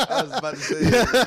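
laughing. Real, not acted. Laughing at the shared joke.

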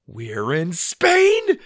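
slow build to a loud squeal; high, drawn-out astonishment; shocked disbelief. A narrator's reading. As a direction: blurt it in stunned disbelief. Boy shocked to be abroad.

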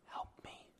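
a breathy unvoiced "Help me."; retold whisper. Host retelling the caller's last words on the call, whispered. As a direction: whisper "Help me." like a faint voice on the tape. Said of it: "in a whisper".

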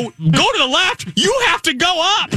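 high, frantic mock yell back; retold screaming argument. Pilot arguing with co-pilot over steering, mock screaming. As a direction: argue back in an exaggerated panic. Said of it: "I'm screaming at him".

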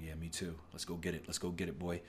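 softly voiced eager agreement; hushed let's go; quietly egging his partner on; quiet hype.

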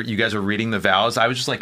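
ordinary delivery

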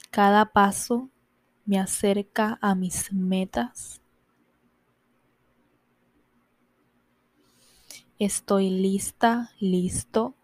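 slow, drawn-out affirmations with long pauses; very unhurried meditation guide; guided affirmations. Acted. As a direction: recite each affirmation slowly, leaving room to repeat it. Recites affirmations for listeners.

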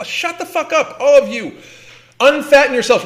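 high-pitched, fed-up outburst; exasperated scolding, rising in pitch; real exasperation. Real, not acted. Fed-up rant against fat-positive media.

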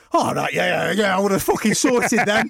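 big talk with a laugh in it; hammed-up hard man, rising in pitch; mock bravado. Mock bravado once danger has gone.

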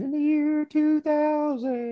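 high, very slow, warbling falsetto; mock Conan O'Brien falsetto; Conan bit. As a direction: sing it out like a spooky prophecy. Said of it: "was it Conan O'Brien?".

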